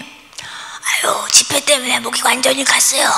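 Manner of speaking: imitating a pastor hoarse from preaching at meetings; strained, breathy croak with hardly any voice; voice completely gone. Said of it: "목소리가 완전히 간 거예요". (목이 완전히 간 쉰 목소리 흉내)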